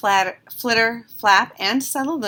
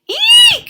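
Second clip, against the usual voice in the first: a sudden piercing high eek, very loud; shocked shriek; 14 dB louder than usual. A narrator's reading. Mama Bird shrieks seeing babies hanging, piercing eek. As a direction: shriek in alarm at the sight. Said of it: "she cried".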